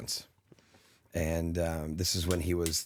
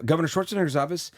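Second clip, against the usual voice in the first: brisk, higher receptionist answer; secretary voice; 8 semitones higher, faster than usual. Retold or imitated. Governor's secretary picking up the call, brisk and higher. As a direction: answer like a busy front desk. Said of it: "a secretary answers".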